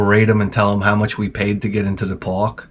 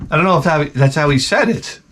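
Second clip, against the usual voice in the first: usual speaking voice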